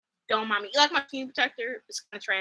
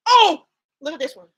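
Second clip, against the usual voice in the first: excited; 3 semitones higher, 10 dB louder than usual. Real, not acted. Excited about a clip.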